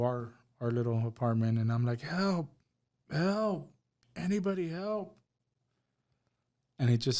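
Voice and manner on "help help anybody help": high, urgent cries for help; calling for help. Retold or imitated. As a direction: call out to the neighbours for help. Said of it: "I'm screaming for help".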